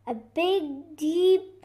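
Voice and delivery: plain reading voice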